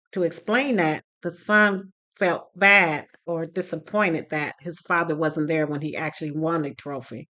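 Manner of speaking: ordinary talk, nothing marked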